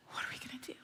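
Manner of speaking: breathless unvoiced whisper; worried whisper. Couple at night fearing the future, whispering. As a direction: whisper it like a secret fear. Fearful moment retold.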